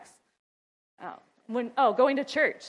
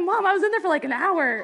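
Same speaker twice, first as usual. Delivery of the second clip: put-on higher, louder complaining child voice; imitating the kid; 7 semitones higher, 6 dB louder than usual. Child complaining to mom about being left in the bathroom, higher mock whine. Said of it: "And they're like".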